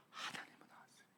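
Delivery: hushed secret whisper. Real, not acted. Preacher whispering to congregation. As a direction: lean in and whisper it.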